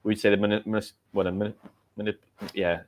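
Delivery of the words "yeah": usual speaking voice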